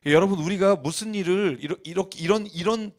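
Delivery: usual speaking voice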